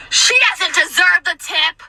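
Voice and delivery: high, slow, wailed complaint; mock screaming. Customer complaining about server to companions, screaming. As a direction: imitate an outraged customer screaming. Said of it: "she's screaming about me".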